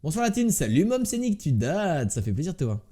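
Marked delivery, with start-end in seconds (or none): none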